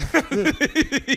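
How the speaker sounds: short, high burst of laughter. Real, not acted. Teller laughs as the robber gives up, high laugh. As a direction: crack up.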